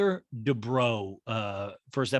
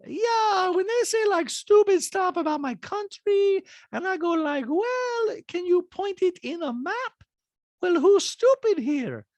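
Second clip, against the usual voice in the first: Diana's accent impression; 4 dB louder than usual. Host imitating Diana's talking head, very high Bosnian accent. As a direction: deliver it in a put-on Bosnian accent, deadpan. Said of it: "Diana's like".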